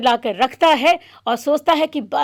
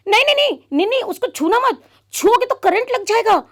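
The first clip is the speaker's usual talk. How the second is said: alarmed warning; 9 semitones higher than usual. Acted. Stopping friend touching radio.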